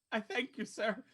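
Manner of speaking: high, tearful thanks; Sybil. Acted. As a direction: thank him as if close to bawling. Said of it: "she's just bawling her eyes out".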